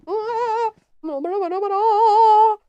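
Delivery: high, loud mock blah-blah; mocking gibberish. Mocking a wrestler with loud, high blah-blah. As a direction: squawk nonsense to mock her.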